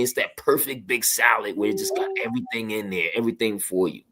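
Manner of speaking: ordinary talk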